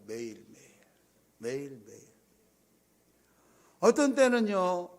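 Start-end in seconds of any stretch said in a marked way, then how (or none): 0.0-2.0 매일매일 repeated much lower and quieter; soft echo. Real, not acted. Pastor echoing his own words: a soft, low 매일매일. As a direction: let 매일매일 fall away softly, almost to yourself. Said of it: "매일매일 그렇게 살아요".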